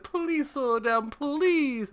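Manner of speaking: very high, slow, begging; mock terrified plea. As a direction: plead like someone scared. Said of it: "She's like".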